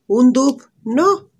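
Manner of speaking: surprised. Real, not acted. Checking whether it's a dupe.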